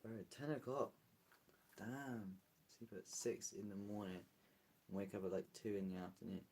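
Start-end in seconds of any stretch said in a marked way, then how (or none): none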